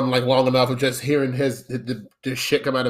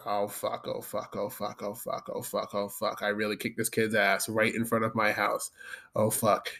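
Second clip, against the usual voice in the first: much softer, panicked mutter under the breath; 5 semitones lower, 9 dB quieter than usual. Retold or imitated. On the steps after kicking a kid, muttering in panic. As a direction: mutter in quiet dread. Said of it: "I'm sitting on my steps like".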